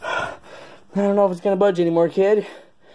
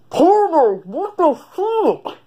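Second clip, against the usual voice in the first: much higher, stretched-out cowboy take; high cowboy voice try; 10 semitones higher, 3 dB louder, much slower than usual. Acted. Sheriff trying a new voice, high and drawn out. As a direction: squeak it out like a slow sheriff. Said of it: "That's closer".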